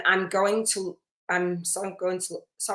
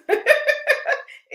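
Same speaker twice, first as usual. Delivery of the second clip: high laugh; 5 dB louder than usual. Real, not acted. Laughs after amen call.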